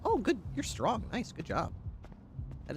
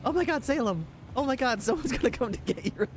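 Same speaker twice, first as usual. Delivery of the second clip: imitating a colleague's high, excited gush; 11 semitones higher than usual. Officer imitating the sheriff gushing over his dog, high and excited. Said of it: "she was like".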